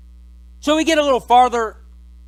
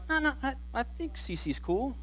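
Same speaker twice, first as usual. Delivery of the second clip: half-hearted defence; 5 semitones higher, 12 dB quieter than usual. Acted. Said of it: "they might be like".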